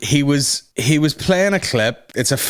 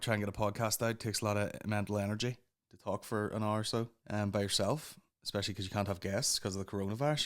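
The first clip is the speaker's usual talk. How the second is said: quiet; 5 semitones lower, 16 dB quieter, faster than usual. Real, not acted. Sudden very quiet segment.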